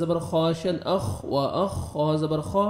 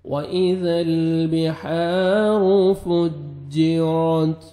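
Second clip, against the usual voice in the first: Quran recitation; 4 dB louder, much slower than usual. Shown as a demonstration. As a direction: chant the verse slowly like a qari.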